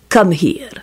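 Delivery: understated silky command. A narrator's reading. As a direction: beckon with smooth menace. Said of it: "Radu said silkily to the goblin".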